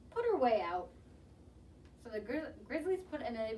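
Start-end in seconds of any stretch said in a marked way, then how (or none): 0.1-0.9 louder, contained team shout; mild shout. A narrator's reading. Angry team banishing her to the outfield, mild shout. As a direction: push it out firmly. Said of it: "they shouted".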